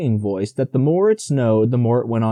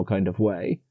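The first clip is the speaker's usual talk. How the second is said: plain reading voice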